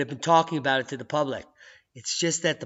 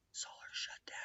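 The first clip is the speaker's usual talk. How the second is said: hushed whisper over the data; awed whisper; 23 dB quieter than usual. Acted. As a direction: whisper as if it's too secret to say. Dramatic hushed reveal.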